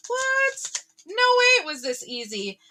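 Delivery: high, squeaky What? then a stunned rush; shocked disbelief. Retold or imitated. Viewer stunned the job came so easily, squeaking in disbelief. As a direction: gasp it out like you can't believe it. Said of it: "kinda shocked".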